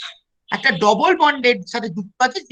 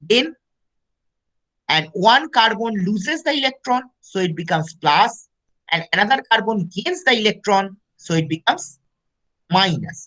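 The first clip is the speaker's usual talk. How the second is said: slow dictation; much slower than usual. Acted. Teacher dictates notes in English.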